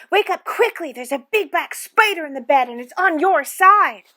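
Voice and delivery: loud high-pitched alarm; scary, urgent. A narrator's reading. Joan tricking her sleepy sister about a spider, scary and urgent. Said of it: "making her voice scary and urgent".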